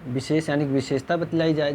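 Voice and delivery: ordinary delivery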